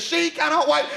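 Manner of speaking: ordinary talk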